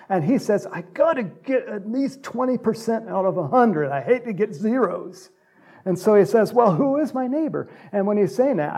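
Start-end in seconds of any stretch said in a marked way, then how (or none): 0.7-5.3 higher, pleading bargainer's voice; a lightly raised, pleading test-taker; lawyer bargaining. Retold or imitated. 6.5-7.7 high, raised, testing question; raised, challenging who is my neighbor; lawyer's question. Retold or imitated. Lawyer's question to Jesus, acted.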